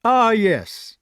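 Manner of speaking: put-on high, loud, flustered old knight; a fussy squire caught off guard; nervous old gentleman blurting out a greeting; flustered Sir Ector. A narrator's reading.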